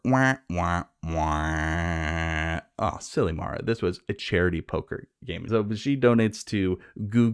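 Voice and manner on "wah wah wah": mock "wah, wah, waaah"; sad trombone imitation. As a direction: drone a descending losing-game trombone with a long last note. Imitates sad trombone after poker letdown.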